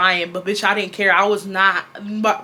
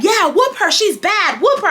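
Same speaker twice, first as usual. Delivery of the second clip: imitated dad, much higher pitched; lightly mocked parent urging on a punishment; egging-on dad; 12 semitones higher, 4 dB louder than usual. Dad backing mom during the whooping, mock egging on.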